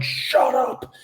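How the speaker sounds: high-pitched retold yell, held back in volume; mock yell. Investigator imitates husband yelling at the girl, high mock yell. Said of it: "he's yelling at the chick".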